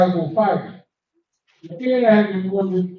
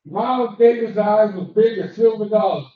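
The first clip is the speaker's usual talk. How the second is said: usual speaking voice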